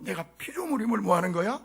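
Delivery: ordinary talk, nothing marked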